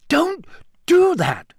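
very high, slow, alarmed protest; startled scolding. A narrator's reading. Harry scrambling away from the elf, startled scolding. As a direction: protest in shock, stretching each word.

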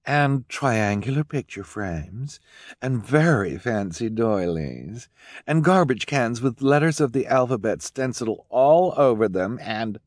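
high, slow listing of trends; snooty socialite Esme. Acted. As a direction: savor each fashionable item. Esme lists in things.

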